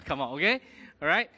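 ordinary talk, nothing marked

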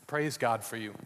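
usual speaking voice

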